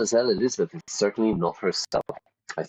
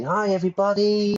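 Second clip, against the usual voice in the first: bubbly greeting; 12 semitones higher, 3 dB louder than usual. Shown as a demonstration. Mimicking bubbly personality.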